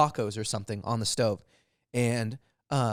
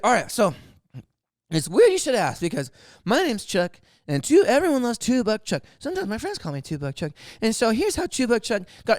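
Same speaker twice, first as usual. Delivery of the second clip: high, loud holding-forth voice; imitated drunk storyteller; 10 semitones higher, 6 dB louder than usual. Drunk guest telling dinner party his story, imitated excitement. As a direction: launch eagerly into a tale, imitated.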